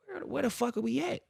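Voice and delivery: very high-pitched, incredulous question; mock alarm. Stranded driver learns of the delay, high mock-alarmed question. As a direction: squeal it in hammed-up disbelief. Alarmed at being somewhere unknown.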